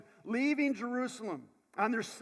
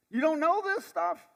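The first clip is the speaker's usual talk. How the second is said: incredulous; 8 semitones higher, 7 dB louder than usual. Retold or imitated. Disciples amazed at the stranger.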